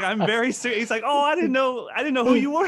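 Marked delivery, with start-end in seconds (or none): 0.0-0.8 excited insistence. Retold or imitated. Retelling his own insistence. 1.1-2.7 much higher and louder, caught off guard; hammed-up flustered apology; flustered teacher. Teacher's flustered reply, voiced.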